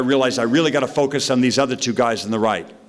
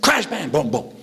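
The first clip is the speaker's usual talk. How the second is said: crash bang boom; 4 semitones higher than usual. Retold or imitated. Crash sound effect words.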